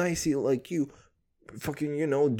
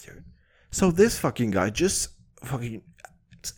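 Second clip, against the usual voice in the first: normal talking voice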